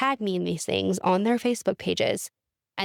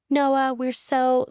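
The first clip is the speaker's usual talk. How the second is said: reading the card aloud; 6 semitones higher, 4 dB louder, much slower than usual. Retold or imitated. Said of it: "She was like".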